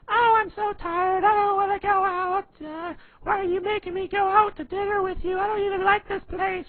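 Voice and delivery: put-on loud, whining falsetto caricature of a girlfriend; exaggerated squeaky girl voice; high-pitched shrill girlfriend. Shown as a demonstration. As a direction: badly impersonate a complaining woman, shrill and loud. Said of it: "really high-pitched, shrilly voice".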